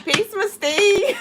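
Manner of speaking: high, slow giggly voice; silly high greeting. Pretend. Streamer greeting a viewer with a giggle.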